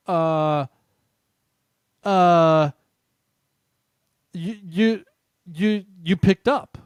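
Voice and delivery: stammering, halting mock caller; lightly hammed-up confused stammer. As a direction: imitate a baffled stranger stumbling over words. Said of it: "they sounded very confused".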